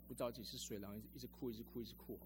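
ordinary delivery

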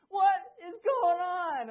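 slow, high, very loud bellow; alarmed dad's shout. Retold or imitated. Dad running upstairs to screaming son, alarmed drawn-out shout. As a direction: bellow each word, drawn out.

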